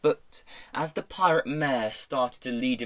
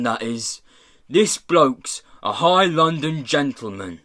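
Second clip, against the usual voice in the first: louder rough exclaiming, settling into reproach; pirate boss, impressed; 4 dB louder than usual. Acted. Pirate boss showing companions a London gentleman, impressed. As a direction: announce it to the gang, then scold.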